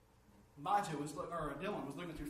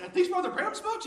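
much higher and louder, thrilled; excited question; 13 semitones higher, 11 dB louder than usual. Retold or imitated. Asking the brother about the books, thrilled and loud. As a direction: burst out with the question.

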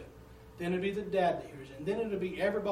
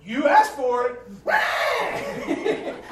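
put-on baby fed up; 7 semitones higher, 7 dB louder, much slower than usual. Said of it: "and he's like".

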